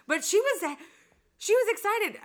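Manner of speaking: excited retelling. Real, not acted.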